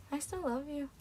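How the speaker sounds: very soft, almost whispered love confession; hushed mock plea. Mock caller on the phone to the speaker, hushed plea. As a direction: say it tiny and hushed. Repeats the joke call, hushed.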